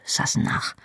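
soft teasing whisper; a teasing whisper to his wife. Acted. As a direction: drop it to a fond whisper.

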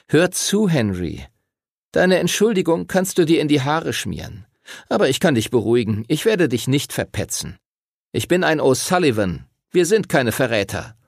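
put-on a sneering boy rubbing it in; Timothy's voice. A narrator's reading. Timothy taunts Henry about his secret, mocking.

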